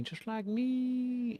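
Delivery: very high, drawn-out squeak; high playful squeal. Pretend. Streamer delighted at a kindred character, high drawn-out squeal.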